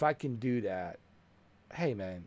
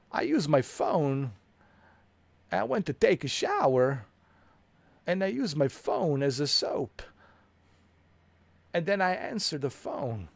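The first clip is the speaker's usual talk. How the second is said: a goofy morning tale told for laughs; silly comic voice; 4 semitones higher, 3 dB louder than usual. Pretend. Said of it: "Or I'll say something like".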